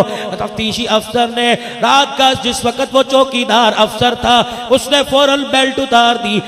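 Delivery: loud, fervent sermon storytelling; full-force preaching. Acted. Preacher tells the crowd the story, fervently and loudly. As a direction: drive the story home to the crowd.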